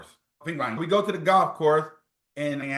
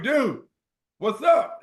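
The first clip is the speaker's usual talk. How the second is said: surprised call; 10 semitones higher, 4 dB louder, slower than usual. Retold or imitated. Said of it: "So I'm like".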